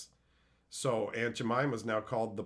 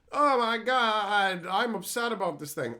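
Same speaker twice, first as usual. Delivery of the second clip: mock the people who get worked up online; mock outrage; 8 semitones higher, 5 dB louder than usual. Mocking internet complainers in a whiny voice.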